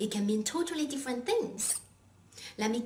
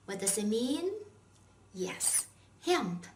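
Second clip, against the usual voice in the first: ordinary talk, nothing marked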